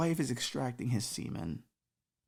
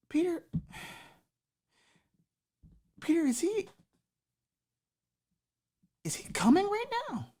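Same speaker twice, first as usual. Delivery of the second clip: much higher, strained Jesus voice; Jesus horrified, asking Peter; panicked Jesus. Acted. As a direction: squeak it out in disbelief. Jesus panics over the guy.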